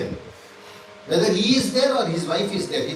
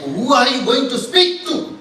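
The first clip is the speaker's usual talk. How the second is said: slow, raised challenge, half laughing; mock menace from the angry attendants; angry retinue; 7 semitones higher, 7 dB louder than usual. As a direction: laugh a little while threatening, higher. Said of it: "the other retinue are angry".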